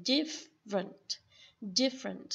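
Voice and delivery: "different" slow, the middle schwa glided over; different with gliding schwa. Shown as a demonstration. A teacher models "different" for her student, gliding the middle vowel. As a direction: slide lightly through the middle of "different". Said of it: "Âm ơ này nó sẽ lướt".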